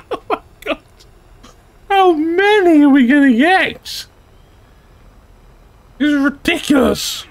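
very high, squealing excitement; giddy disbelief. Real, not acted. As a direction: squeal it in real, giddy amazement. Giddy disbelief at kill streak.

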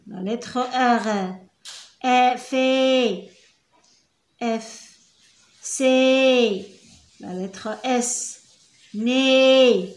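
"ا في" dragged out sound by sound; slow syllable demo. Shown as a demonstration. Teacher to pupils, drawing each sound out. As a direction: spell "ا في" very slowly for the class. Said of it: "كنقراوها".

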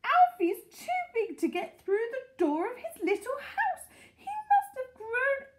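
high, loud cry of amazement; high-pitched, astonished shouting; excited shouting. A narrator's reading. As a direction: shout the astonishing news to a neighbour. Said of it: "Missus Silver was shouting".

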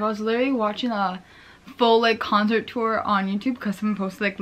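ordinary talk, nothing marked

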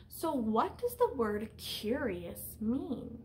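usual speaking voice

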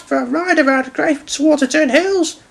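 high-pitched, proud engine voice; high smiling character; smiling little engine. A narrator's reading. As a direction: explain cheerfully as a tiny engine. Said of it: "smiled Stanley".

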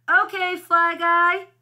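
high, loud, drawn-out call; yelling. A narrator's reading. Buzz calls out to his hidden fly, yelling. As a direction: yell it out to someone far off. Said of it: "yelled Buzz".

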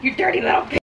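hissed, high insult; mean self-talk. Pretend. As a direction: sneer it at yourself. Acting out negative self-talk.

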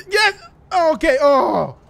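high, loud cry of effort; relieved cry. Acted. Climbing out of the water at last, relieved. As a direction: cry out as you haul yourself up.

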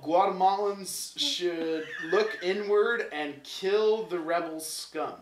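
loud, slow, hammed-up battle cry; mock rallying shout. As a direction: bellow it like a fake dictator.

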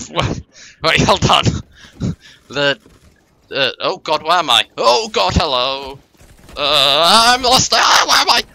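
high, loud, breathless panic; lost and flustered, calling out; panicking. Real, not acted. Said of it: "hold on, I'm panicking".

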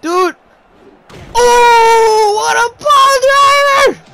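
high loud hyped shout; excited yell. Real, not acted. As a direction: scream it in delight. Hyped reaction to big move.

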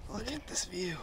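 hushed awe at the view; whispering. Real, not acted. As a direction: breathe it out in a whisper. Awed at summit view.

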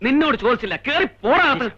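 high, sharp telling-off; angry rebuke. Acted. Security officer cutting off the servant who spoke up, high and angry. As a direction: put him in his place, fast and sharp.

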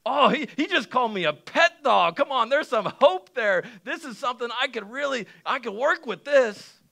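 high, loud, eager realization; excited hope. Acted. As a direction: act out seizing on a sliver of hope. Acts out woman's sudden hope.

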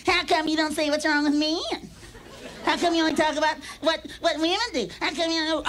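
high whiny heckling voice; mock audience heckler. Audience member nagging the comic, high and whiny. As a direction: whine it like a nagging woman in the crowd. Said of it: "they're always on the audience going".

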